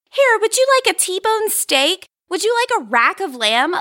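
doting dog owners. Retold or imitated. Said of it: "they're like".

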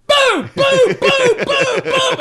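very high, loud cartoon booms; mock smashing booms. Pesci smashing the driver on the can, hammed-up booms. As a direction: bash out each boom like a club. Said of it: "walks over to the garbage can and goes".

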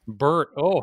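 usual speaking voice

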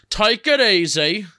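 high, loud "take it easy"; mock calming. Calming the host down.

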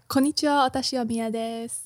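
usual speaking voice

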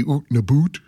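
"oot and a boot" with Canadian oo vowels; Canadian accent, "oot" for out. Shown as a demonstration. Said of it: "the way some people will say it, like that, uh, Ontario accent".

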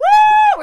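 high screaming woo; party woo. Pretend. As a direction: whoop like a rock star. Rock star party shout.

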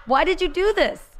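put-on high, pleading childish whine; whiny kid complaint. Young Nikki complaining to her mom, high whiny pleading. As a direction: play the upset kid demanding answers.

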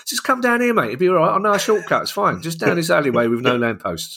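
high, matey mock-friendly lure; mock Millwall fan. Millwall fan coaxing a Palace fan down an alley, high mock-friendly voice. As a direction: play the dodgy local coaxing a visitor into a dark alley. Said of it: "not to accept an invite to a Millwall game from Millwall".

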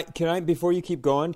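ordinary talk

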